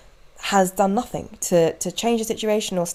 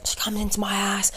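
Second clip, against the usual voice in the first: hushed gossip voice; overheard whisper; 2 semitones higher, 6 dB quieter than usual. Retold or imitated. Quoting the stranger's story.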